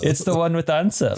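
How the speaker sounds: put-on Scandinavian accent. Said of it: "a Scandinavian accent".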